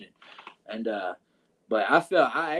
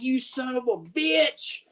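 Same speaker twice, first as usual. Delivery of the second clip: higher, slow, snarling mock threat; angry Mark Southern; 9 semitones higher, slower than usual. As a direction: play him charging through the door. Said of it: "He's like".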